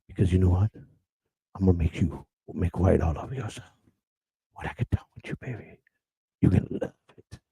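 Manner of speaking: low breathy hush to Peaches; hushed come-on. Pretend. Host teasing Peaches in chat, hushed seductive tone. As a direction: draw them in close and soft.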